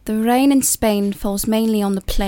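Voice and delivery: Keira Knightley. Shown as a demonstration. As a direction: recite it slowly in an English accent. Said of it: "I can do a Keira Knightley accent".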